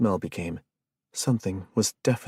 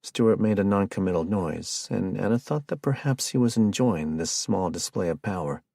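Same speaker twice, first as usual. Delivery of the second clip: usual speaking voice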